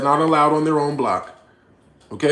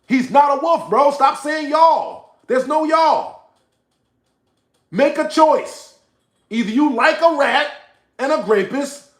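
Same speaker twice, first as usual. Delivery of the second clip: real yelling; 12 semitones higher, 4 dB louder than usual. Real, not acted. Streamer ordering a viewer to choose a side, yelling. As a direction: yell each demand at the top of your voice. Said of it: "sorry I had to yell at you".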